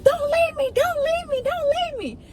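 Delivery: put-on very high, pleading girl's voice; squeaky imitation of a begging girl. Girl begs narrator to stay.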